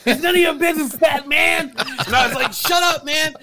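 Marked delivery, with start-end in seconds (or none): 0.0-1.7 high-pitched, indignant mock shout; retold comeback with pitch way up; imitated yelling. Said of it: "he starts yelling at me". 2.5-3.3 high, abrupt mock shout; worked-up retort, pitched way up; retold yelling back. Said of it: "I'm yelling back at him".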